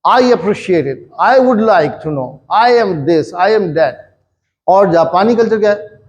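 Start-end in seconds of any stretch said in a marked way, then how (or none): 0.1-4.0 1 self-important. Retold or imitated. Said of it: "हर चीज़ जो है ना मेरी तरफ है".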